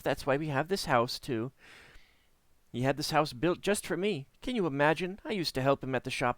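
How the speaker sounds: a hint of a breathless talker; a touch of a matchmaking hostess; chatty woman. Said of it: "talk without ever taking a breath".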